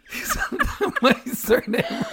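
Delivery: high laughing disbelief. Real, not acted. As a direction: laugh through the words.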